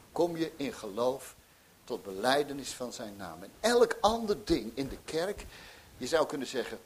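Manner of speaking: normal talking voice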